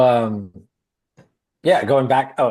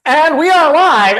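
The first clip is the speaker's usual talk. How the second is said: much higher, louder, slow "live" opener; anchor voice; 12 dB louder, slower than usual. Retold or imitated. Woman pranking a scam caller, in a booming anchor voice. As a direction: burst into a news broadcast. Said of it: "immediately goes into her broadcasting".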